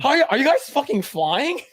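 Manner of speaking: the Predator reacting to Michelle Yeoh's flying leaps, laughing and high; baffled Predator. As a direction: squeal it like a bewildered alien hunter. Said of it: "And then Predator's like".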